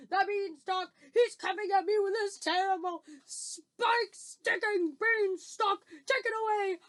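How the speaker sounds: loud, high, frantic pleading; screamed begging. Acted. Giant begs Jack for mercy, wailing in terror. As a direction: beg for your life in a wail. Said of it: "screamed the flesh lump eater".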